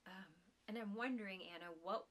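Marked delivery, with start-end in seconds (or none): none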